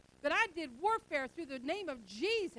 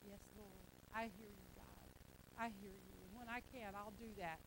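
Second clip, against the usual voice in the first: much softer, half-hearted yes; mumbled lazy reply; 7 semitones lower, 17 dB quieter than usual. Retold or imitated. Believer lazily mumbling back to God, half-hearted. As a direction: brush God off under your breath.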